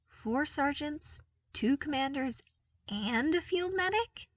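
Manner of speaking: lists them in growing bewilderment; bewildered. A narrator's reading. Luke, staring at seven men, asks bewildered. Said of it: "he asked, bewildered".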